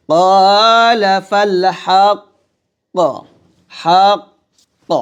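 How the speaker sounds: very slow recitation, echoing হাক্ক; chanted Quran line; recitation demo. Shown as a demonstration. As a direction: bounce the final ক্ক of হাক্ক. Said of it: "এরকম".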